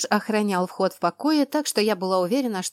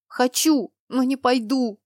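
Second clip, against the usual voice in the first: stubborn, higher-pitched sulk; sulky; 5 semitones higher, 3 dB louder, slower than usual. A narrator's reading. Girl wants him but refuses marriage, sulking. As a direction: dig in sullenly. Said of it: "Насупилась оборотница".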